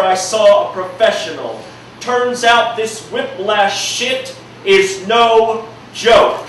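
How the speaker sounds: rhythmic slam poetry delivery, punching key words; spoken word poem. Acted. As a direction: perform it like a slam poem, building intensity. Performs his spoken-word poem.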